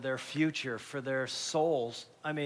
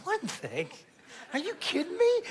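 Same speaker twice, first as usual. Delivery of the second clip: shoots much higher, incredulous; can't believe it was only one; stunned disbelief; 13 semitones higher than usual. Retold or imitated. Father's disbelief in retelling.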